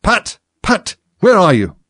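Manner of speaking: high, loud angry call; angry rabbit shouting. A narrator's reading. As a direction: yell crossly for a servant. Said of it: "Next came an angry voice, the rabbit's".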